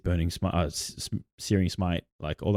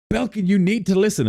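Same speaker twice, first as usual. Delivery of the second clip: much higher mock plea; pleading party member; 13 semitones higher, 4 dB louder than usual. Party urging their gruff leader, in mock high plea. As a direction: squeak it like an eager adventurer. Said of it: "they tried to talk to him like".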